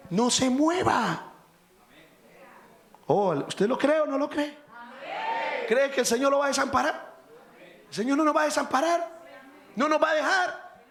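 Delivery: high, fast shouted assurance; fervent preaching. Acted. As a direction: drive the promise home. Preaching at full force.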